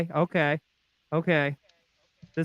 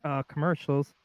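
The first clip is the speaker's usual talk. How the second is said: ordinary talk, nothing marked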